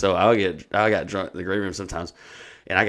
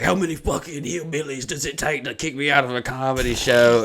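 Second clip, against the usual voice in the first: mock indignant rant, voice pitched up; retold freak-out; 6 semitones higher than usual. Heckler to the men dragging him out, retold freak-out. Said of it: "he starts wigging the fuck out".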